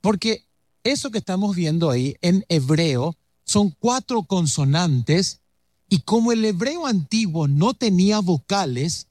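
usual speaking voice

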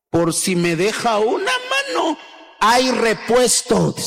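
slow, swaggering brag with a high yelp on "mano"; hammed-up show-off answer to his friend; cocky boast. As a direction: brag like a two-timing lad. Boyfriend boasting of two girlfriends.

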